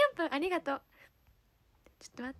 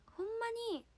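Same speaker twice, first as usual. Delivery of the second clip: hesitant stab at Kansai intonation; amateur Kansai lilt; pretend Kansai dialect; 8 semitones higher, faster than usual. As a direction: ease into a practice Kansai accent. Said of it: "じゃあ関西弁ね。".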